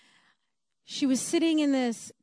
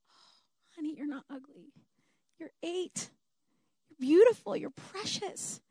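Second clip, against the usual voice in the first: tender reassurance; 3 semitones higher, 5 dB quieter than usual. Retold or imitated. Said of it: "comforting a child".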